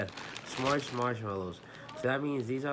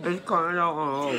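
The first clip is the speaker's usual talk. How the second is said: louder, higher, mumbling through marshmallows; mouth full; 7 semitones higher, 8 dB louder than usual. Real, not acted. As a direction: struggle to talk past a full mouth. Mouth stuffed, laughing.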